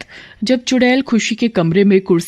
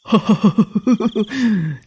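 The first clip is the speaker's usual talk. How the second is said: short mocking witch laugh; evil witch queen laugh; witch cackle; 3 semitones higher than usual. Queen witch catches Shalu.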